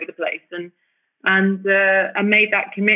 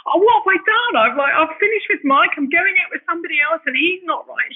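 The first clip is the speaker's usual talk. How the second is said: mock tearful wail; 9 semitones higher than usual. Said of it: "I'd be in tears going".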